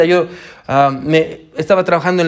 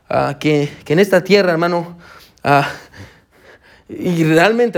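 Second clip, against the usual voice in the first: ordinary talk, nothing marked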